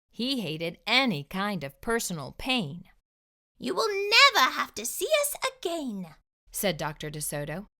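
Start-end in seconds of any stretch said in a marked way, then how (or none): 3.6-6.1 high squeaky promise; high mouse voice. Acted. Dentist reassuring the fox, high voice. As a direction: say it small and bright.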